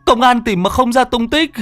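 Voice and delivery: high, strained begging voice; a frightened father begging the shaman; urgent high plea for his lost daughter; desperate pleading. Acted. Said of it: "nghe tiếng gọi".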